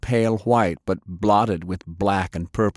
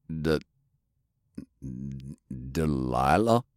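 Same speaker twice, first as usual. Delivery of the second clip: low, halting, disbelieving; stunned, faltering; 6 semitones lower, 6 dB quieter than usual. A narrator's reading. Man seeing his lost love, stunned and faltering. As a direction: falter on the name, barely daring to ask.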